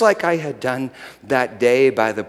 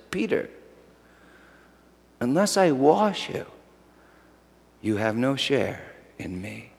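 gentle Jesus; 5 dB quieter than usual. Acted. Jesus answering Peter, soft tender reassurance. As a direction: let it land softly on Peter.

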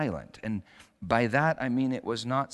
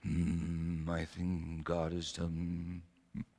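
low, hushed mock whisperer voice; mock God whisperer; 6 semitones lower, 8 dB quieter, slower than usual. Preacher mocking the self-styled God whisperer, hushed mock voice. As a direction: send up a pious murmur, softly. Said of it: "he wasn't the God whisperer".

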